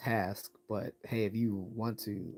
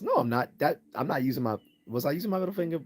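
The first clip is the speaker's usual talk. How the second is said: indignant, louder and higher; loud denial; 4 semitones higher, 8 dB louder than usual. Real, not acted. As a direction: protest the accusation hard. Denies flipping viewers off.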